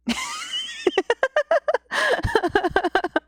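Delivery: high, rolling laughter; long laugh. Real, not acted. Storyteller laughing after her moral, long real laugh. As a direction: burst out laughing and keep it going.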